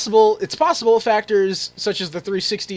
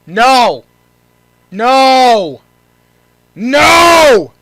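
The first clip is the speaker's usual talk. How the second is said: loud furious shouts of no; angry shouts; 4 semitones higher, 11 dB louder, much slower than usual. Real, not acted. Gamer yelling at the bad news, furious. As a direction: yell each no louder and higher.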